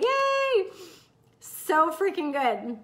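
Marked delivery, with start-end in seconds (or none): 0.0-0.7 high-pitched drawn-out yay; excited cheer. Real, not acted. Coach reacts to listener's comment, excited cheer. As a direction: squeal it with delight.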